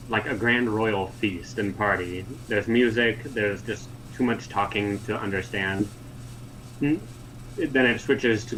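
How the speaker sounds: usual speaking voice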